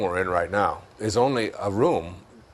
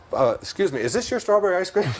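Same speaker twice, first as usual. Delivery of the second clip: polite stranger's question, rising much higher into a laugh; 8 semitones higher than usual. Acted. Laughing while voicing a stranger offering ice cream. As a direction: ask it politely and giggle at the end.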